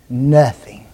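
Nothing set apart alone with a falling close; stressed Nothing. Shown as a demonstration. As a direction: isolate Nothing and let it land. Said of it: "a word you need to underline".

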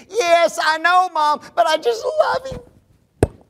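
high, drawn-out swooning plea; lovesick daughter. Retold or imitated. Daughter defending her love to her parents, swooning. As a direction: melt on the final words.

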